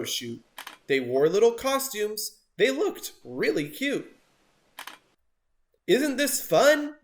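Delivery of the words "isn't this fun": 1 gleeful. A narrator's reading. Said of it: "giggled Trevor with glee".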